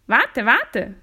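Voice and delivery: frog's loud, swooping call. A narrator's reading. Frog calling after the fleeing princess, urgent. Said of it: "rief der Frosch".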